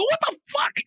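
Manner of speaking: high, laughing what the fuck; stunned disbelief. Retold or imitated. Wrestler caught off guard by opponent's move, stunned outburst. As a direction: blurt it out in shock. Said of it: "I go".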